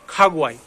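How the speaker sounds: खागुआई with KH first, GU after. Shown as a demonstration. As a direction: say खागुआई as "khaa-gwaa-ee". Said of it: "खोता का ख का sound लेता हूँ".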